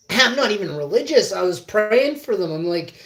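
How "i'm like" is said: usual speaking voice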